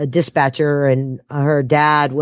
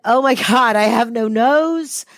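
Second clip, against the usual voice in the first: mock panic; 7 semitones higher than usual. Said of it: "I'm thinking".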